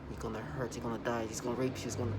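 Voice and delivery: low, soft imitation of a menacing woman; a hint of a menacing whisper-like threat. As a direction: quietly mimic her threatening tone. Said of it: "she just tried to sound, like, menacing".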